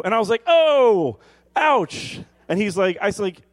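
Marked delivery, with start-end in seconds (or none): 0.5-2.2 high, loud play-acted ouch; drawn-out comic cry of pain; mock pain cry. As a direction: yelp as if stabbed, hammed up. Acts out being stabbed.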